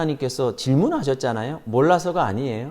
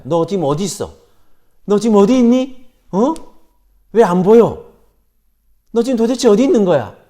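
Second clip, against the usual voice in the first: louder and higher, building to a fast frantic demand; demanding shout; 6 semitones higher, 8 dB louder than usual. Pretend. Imagined God who doesn't know yells for Adam, rising and frantic. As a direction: yell it like an impatient searcher. Harsh not-knowing version of question.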